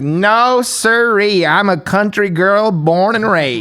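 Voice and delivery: mock Southern drawl, slow; hammed-up country girl from Tennessee; Tennessee country lady. Imitating the TSA officer bragging to the traveller, slow mock Tennessee accent. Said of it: "hefty country lady, Tennessee".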